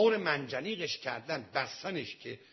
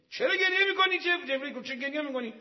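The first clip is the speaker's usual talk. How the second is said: high, loud startled question; urgent questioning; 12 semitones higher, 8 dB louder, faster than usual. Acted. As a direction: call out the question with sudden force. Retold angel questioning weeping father. (پرسش بلند و پرهیجان)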